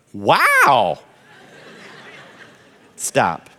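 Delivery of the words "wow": high, loud, amazed wow; impressed wow. Shown as a demonstration. Spouse answering the dreamer's crazy idea, high amazed wow. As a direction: sound truly wowed. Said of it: "Here's all you say".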